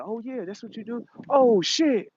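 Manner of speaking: high, mocking, amused darkness voice. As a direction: jeer at the light from way up high. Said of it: "the light like".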